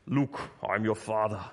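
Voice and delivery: put-on movie villain voice, slightly hammed; not-so-good Darth Vader. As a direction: do an amateur impression of a dark lord. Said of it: "I'm not a good Vader".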